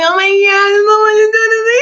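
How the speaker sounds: high, loud hammed-up panic; comic overwhelmed squeal; freaking out. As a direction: shriek it in a rush, mock panicking. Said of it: "freaking out".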